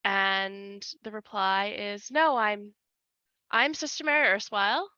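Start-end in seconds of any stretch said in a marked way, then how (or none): 2.1-4.9 put-on American accent, a bit higher and louder; affected American accent. Nun denying her name in a put-on American accent. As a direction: fake American accent to hide who she is. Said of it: "with her best affected American accent".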